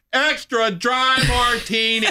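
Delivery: Extra dry martini yelled high and slow; loud and slow. Retold or imitated. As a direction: spell out Extra dry martini loudly, slowly. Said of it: "Louder and slower".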